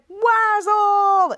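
loud, high, drawn-out cheer; belted game call; group call-out. Retold or imitated. All players call out a word.

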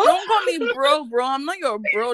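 high, loud, indignant. Acted. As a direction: snap it back.

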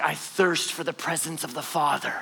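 soft, low, pious murmur; hushed, mock-devout sighing; mock spiritual. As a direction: say it softly like lofty devotion. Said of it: "that is not some spiritual, euphemistic".

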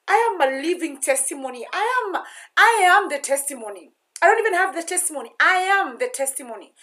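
rising up loud, proclaiming it; impassioned loud declaration. Real, not acted. Proclaiming to viewers she is the testimony, loud and fervent.

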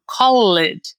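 "call it" as slow "call-LED", stressed ending; Brazilian learner error. Shown as a demonstration. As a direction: make "call it" two syllables, hitting the false "ed". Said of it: "enfatizar sempre esse E D do final". ("call it" devagar, com o "ED" final forte)

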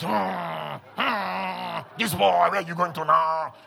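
mock disapproving groan; low, drawn-out grumble of disapproval; murmuring religious listener. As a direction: imitate churchgoers murmuring in doubt. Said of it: "I can hear your murmuring".

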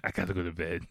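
breathy, exhausted half-whisper; tired mutter to himself; weary whisper. Retold or imitated. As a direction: sigh it out, wiped out after the night. Exhausted after the night.

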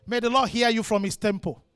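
ordinary talk

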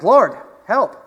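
loud, high call of Lord, help; mock cry for help. Peter crying out to Jesus, loud and high. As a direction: call out like a sinking man. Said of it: "he cried out to Jesus".

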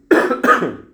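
real cough from a cold; loud throat-clearing cough. Real, not acted. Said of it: "tá um pouquinho gripado esses dias". (tosse de gripado)

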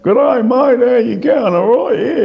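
high, loud broad Aussie accent, hammed up; mock a broad ocker Australian greeting. Guitarist mocking broad Australian greeting, high and loud. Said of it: "that real kind of redneck way of speaking Australian".

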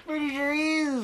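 mock host voice. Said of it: "echoing the host voice".

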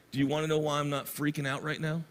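composed, low-key retelling; retold calm reassurance. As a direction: quote her quietly and steadily. Grieving mother's calm quoted answer.

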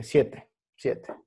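ordinary talk

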